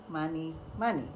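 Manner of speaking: usual speaking voice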